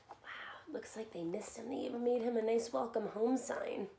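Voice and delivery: plain reading voice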